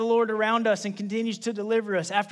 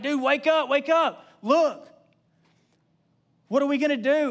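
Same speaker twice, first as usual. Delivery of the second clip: panicked wake-up; 4 semitones higher than usual. Acted. Panicked assistant wakes prophet.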